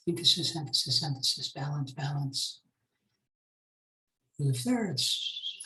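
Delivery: low, soft absent-minded murmur; talking to self. Real, not acted. Teacher hunting through the slides for an image, murmuring to self. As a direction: think aloud quietly while searching.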